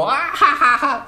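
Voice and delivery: high, loud staccato fake guffaw; exaggerated mock laugh. Shown as a demonstration. Comedian showing an outburst at something really funny, exaggerated laugh. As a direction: burst out in a hammed-up "ha ha ha". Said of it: "you're, like, about to go".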